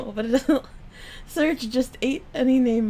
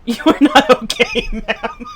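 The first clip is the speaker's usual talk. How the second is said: laughing; 7 dB louder than usual. Real, not acted. Cracking up at the chat partner.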